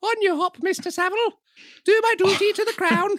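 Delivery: very high, put-on falsetto; hammed-up high royal voice; mock royal falsetto.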